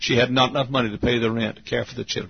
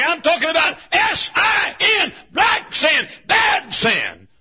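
high, loud hellfire preaching; shouting preacher hammering sin; full-force preaching; 12 semitones higher, 4 dB louder than usual. Acted. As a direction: thunder it from the pulpit.

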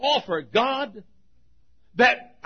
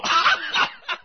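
imitated happy laugh; 8 semitones higher than usual. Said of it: "he'd go".

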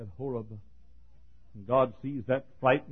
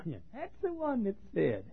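scornful mimicry. Scoffers mocking Peter.

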